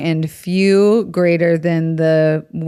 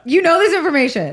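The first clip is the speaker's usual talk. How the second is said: surprised disbelief; 10 semitones higher than usual. Real, not acted. Shocked at friend knowing.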